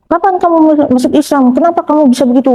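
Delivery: high-pitched imitation of an angry mother; retold scolding. Mother confronting daughter about converting to Islam, retold scolding. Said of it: "saya dimarahin terus".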